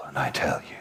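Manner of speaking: hushed confiding aside; whisper. Real, not acted. Speaker confides to the audience in a whisper.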